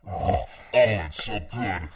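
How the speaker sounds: normal talking voice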